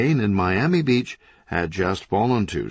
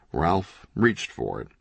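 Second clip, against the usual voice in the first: ordinary delivery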